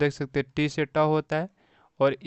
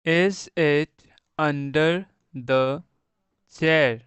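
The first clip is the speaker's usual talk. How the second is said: slow reading aloud; 4 dB louder, much slower than usual. Shown as a demonstration. Teacher reads sentence for class.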